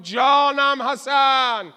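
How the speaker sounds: loud, very slow call, stretching جانم; drawn-out loud cry. Acted. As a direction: cry جانم حسن out long and loud as a battle praise. (ندای بلند و کشیده «جانم حسن»)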